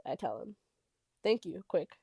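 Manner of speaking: ordinary delivery, nothing marked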